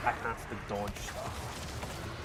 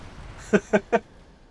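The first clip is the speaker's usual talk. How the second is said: loud, high burst of laughter; real laughing fit after a protest; amused laugh; 10 semitones higher, 9 dB louder than usual. Real, not acted. Streamer laughing at a viewer's comparison, amused.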